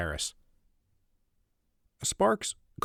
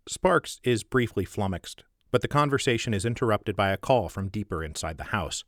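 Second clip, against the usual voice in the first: ordinary delivery, nothing marked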